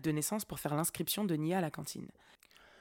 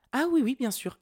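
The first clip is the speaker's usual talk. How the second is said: higher, louder eager agreement, imitated; employée conciliante; 7 semitones higher, 6 dB louder than usual. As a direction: agree readily as the won-over clerk. Imite l'employée soudain conciliante. (employée empressée, imitée, voix plus haute)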